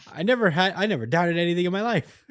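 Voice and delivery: high, loud, fast insistence; loud defiant boast. Retold or imitated. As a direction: yell it like a stubborn hero. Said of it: "Asta's like".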